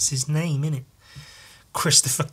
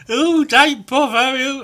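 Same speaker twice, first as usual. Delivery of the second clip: mock cop voice; 9 semitones higher, 5 dB louder than usual. Character voice.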